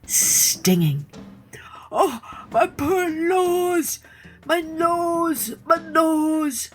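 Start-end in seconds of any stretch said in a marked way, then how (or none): 1.9-6.7 much higher, slow, wailing cry of pain; hammed-up moan for his poor nose; moaning lion. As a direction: howl it like a lion in agony. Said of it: "the lion began to moan".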